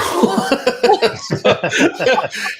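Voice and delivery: big burst of laughter; high, loud laughing fit. Real, not acted. Laughing at own story.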